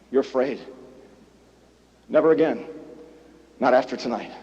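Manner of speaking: low, soft, grave; quiet gravity; hushed solemn. Acted. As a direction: drop it to a hush. Solemn turn to audience.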